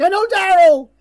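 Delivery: high, loud squealed confession; imitating a prisoner finally breaking; mock cracking confession. Imagined prisoner blurting to guards, high mock squeal.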